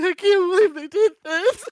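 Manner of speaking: high, loud hysterical wail; mock devastated. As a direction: wail it like a distraught reader, hammed-up. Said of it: "I can't talk because I'm so devastated".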